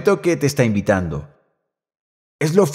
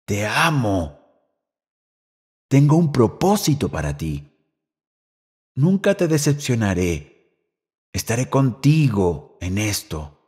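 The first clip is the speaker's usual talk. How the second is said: lower, much softer, unhurried pauses; tender hush; 5 semitones lower, 4 dB quieter than usual. Retold or imitated. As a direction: speak it gently like a quiet promise. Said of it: "escucharlo susurrar". (voz tierna, baja y suave)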